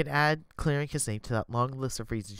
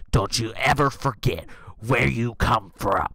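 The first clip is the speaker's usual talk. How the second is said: grandma voice; 3 semitones lower, 5 dB louder, slower than usual. Acted. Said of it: "Grandma finishes".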